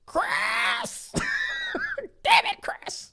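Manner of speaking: high, breathy mock yell of his name; mock angry caller yell. A listener leaving an angry voicemail, mock yell. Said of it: "leave me a voicemail and say".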